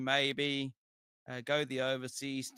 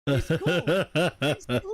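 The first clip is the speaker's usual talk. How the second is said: laughing; 10 dB louder, slower than usual. Real, not acted. Laughing about loud fan Norm.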